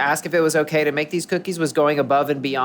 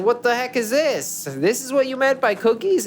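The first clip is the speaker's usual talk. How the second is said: mock outrage; 11 semitones higher than usual. Host retelling sister-in-law's reaction to the cookies, mock outrage. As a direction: act out someone blowing a gasket, hammed up.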